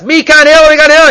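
loud, high shout for Hillel; calling out. Acted. Man at the door summoning Hillel again, high loud call. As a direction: yell for him up high and loud.

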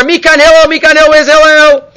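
loud, high shout for Hillel; calling out. Acted. Man at the door summoning Hillel again, high loud call. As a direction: yell for him up high and loud.

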